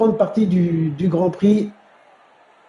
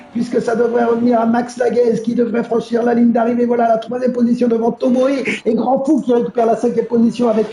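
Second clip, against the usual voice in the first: lively live play-by-play; motorsport commentator; 7 semitones higher than usual. Real, not acted. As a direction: call the race as it happens. (commentateur de course automobile)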